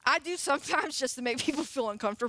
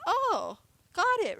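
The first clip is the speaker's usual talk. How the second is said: higher, swooping "oh" of discovery; mock lightbulb moment; dawning realization; 7 semitones higher, slower than usual. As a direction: act out a pupil who just got it. Imagined disciple suddenly understanding Jesus.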